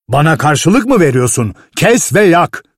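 put-on shouting down a trembling boy; angry order. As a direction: cut him off and bark the order. Master snaps at the boy.

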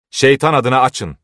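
shouted demand. A narrator's reading. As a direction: call it out urgently, as if through a door. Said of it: "diye bağırdı biri".

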